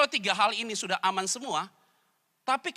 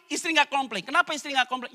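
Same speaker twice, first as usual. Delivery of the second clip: ordinary talk, nothing marked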